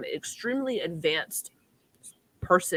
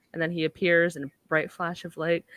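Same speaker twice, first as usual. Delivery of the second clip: usual speaking voice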